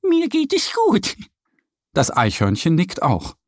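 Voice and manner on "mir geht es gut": cheerful duck. A narrator's reading. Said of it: "Annie lacht".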